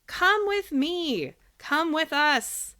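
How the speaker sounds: slow, high, drawn-out coaxing; coaxing Lampwick. A narrator's reading. Lampwick calling Pinocchio into the coach, slow and coaxing. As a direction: lure him along, stretching each word.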